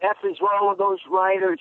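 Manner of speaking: a hint of a tough dad cursing the writers; upset father. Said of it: "he was so upset".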